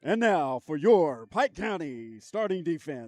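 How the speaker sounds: big booming stadium announcer; slow, loud, drawn-out PA call. Acted. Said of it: "I wanna use my P A voice".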